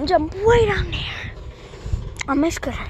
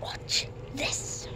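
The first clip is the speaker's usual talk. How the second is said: hushed, breathy near-whisper; 12 dB quieter than usual. Real, not acted. Vlogger teasing viewers before a stunt, hushed. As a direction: whisper it like a secret teaser.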